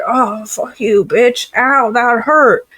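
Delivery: high, hammed-up whine of pain; high-pitched mock hurt; mock pained man. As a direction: imitate a man yelping over a stomped foot. Voices a man whose foot is stomped.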